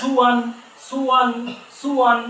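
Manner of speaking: teacher drills সুয়ান; joining syllables. Shown as a demonstration. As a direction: join সু and য়ান smoothly. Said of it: "সিন পেশ সু".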